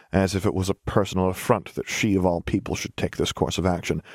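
usual speaking voice

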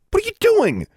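very high, outraged yell; angry shout. A narrator's reading. The boy's friend grabs Alexandra in the fight, outraged shout. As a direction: shout in high-pitched disbelief.

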